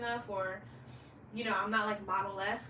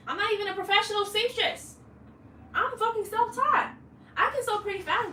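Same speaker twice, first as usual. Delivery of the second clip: exasperated outburst; 11 semitones higher, 9 dB louder than usual. Real, not acted. Frustrated self-directed rant.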